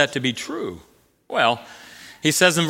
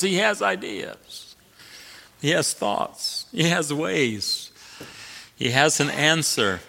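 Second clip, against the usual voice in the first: softer and slow, stress on has; quiet emphasis; 6 dB quieter, slower than usual. Acted. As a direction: drop to a hushed, weighted list. Quiet emphatic list after climax.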